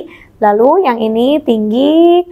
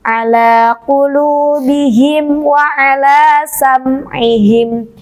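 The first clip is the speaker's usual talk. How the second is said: slow chanted recitation; Quran recitation; much slower than usual. Shown as a demonstration. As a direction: recite slowly with long vowels. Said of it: "Kita lanjutkan lagi".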